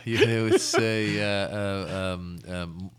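ordinary talk

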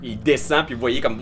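ordinary delivery

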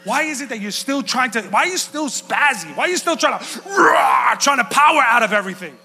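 rising to a loud shout with a cry; coach yelling. Retold or imitated. Jiu-jitsu coach scolding his student, growing to a shout. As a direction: build from a question to a yell. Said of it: "he's like".

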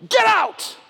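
Paul casting out the demon with a shout; commanding shout. Retold or imitated. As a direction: cast it out with one sharp shout. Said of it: "he goes".

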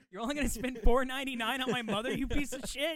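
hammed-up offended son; mock outrage. Cohost faking outrage over a cheap dinner for his mom.

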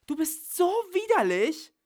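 furious, insulted scream; disgusted yell. Acted. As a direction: scream your disgust at him.

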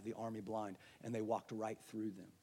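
ordinary delivery

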